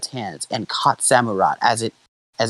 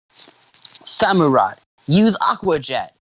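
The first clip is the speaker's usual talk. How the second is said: drawn-out, raised battle command; high, slow-stretched trainer's order; 5 semitones higher, much slower than usual. A narrator's reading. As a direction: order your fighter to attack. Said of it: "Ash ordered".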